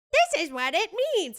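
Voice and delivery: know-it-all commenters. Retold or imitated. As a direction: lecture like an eager commenter. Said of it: "everybody's like".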